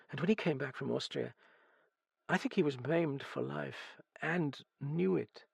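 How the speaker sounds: high, halting confession, often hushed; distraught young heroine, tearful. Acted. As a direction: spill out the story, close to tears.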